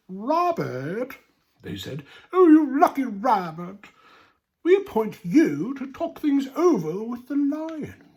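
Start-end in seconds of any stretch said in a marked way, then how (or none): 0.1-1.2 sly animals. Acted. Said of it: "grinned slyly". 2.3-8.0 high, loud, sly coaxing voice; butter the rabbit up with a high, sly sweetness; sly big animals. Acted. Big animals flatter the rabbit into facing the lion, high and sly. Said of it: "grinned slyly".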